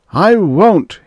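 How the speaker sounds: loud, high shout; shouted refusal. A narrator's reading. Man refuses the voice's command, shouting. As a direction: shout it in defiance. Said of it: "he shouted again".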